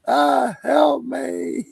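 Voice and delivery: high, slow mock wail; mock cry for help. Toad grabs him, he wails a mock plea for help. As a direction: cry out in hammed-up panic. Toad hug gone wrong.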